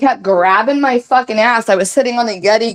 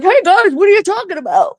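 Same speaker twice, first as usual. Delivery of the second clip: high, goofy mock-cheerful call; goofy, pitched-up butting-in voice; goofy guy; 10 semitones higher than usual. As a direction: imitate him with a big goofy grin. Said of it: "he's got this big goofy grin".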